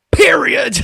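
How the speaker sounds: high, loud, shouted punchline. Retold or imitated. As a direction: bark the last word high and loud like a mic drop.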